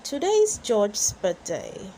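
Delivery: ordinary delivery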